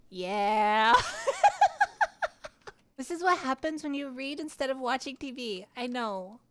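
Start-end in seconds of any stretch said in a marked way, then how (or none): 1.0-2.7 high squealing laugh. Real, not acted. Streamer retelling her first kiss, squealing laughter.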